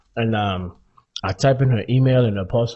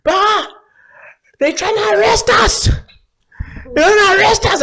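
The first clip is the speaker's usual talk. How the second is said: high, loud, whiny sister voice; hammed-up high panicked falsetto; mock panicked sister; 5 dB louder than usual. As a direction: squeal it like a frantic girl. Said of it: "She's like".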